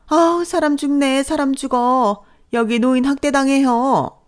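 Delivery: high theatrical cry of elder abuse; mother-in-law wailing for the neighbors; hammed-up wailing. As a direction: play the victim, loud and high.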